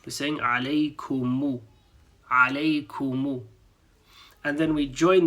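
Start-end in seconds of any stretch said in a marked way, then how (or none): 0.4-3.4 "Alaikumu" with the final u clear; "alaikumu" ending on a sounded u; added final u. Shown as a demonstration. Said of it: "we're adding the u".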